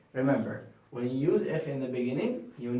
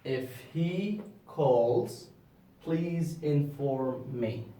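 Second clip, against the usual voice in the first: slow example reading; much slower than usual. Shown as a demonstration. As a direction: read it slowly, word by word.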